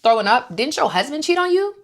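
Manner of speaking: Brittany snapping back at Meredith with sass; sassy clapback. Retold or imitated. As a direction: throw the shade back at her. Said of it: "So Brittany was like".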